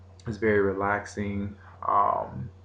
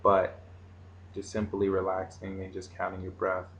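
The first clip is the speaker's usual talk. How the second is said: guided meditation; 4 dB quieter than usual. Acted. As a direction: lead the breathing calmly.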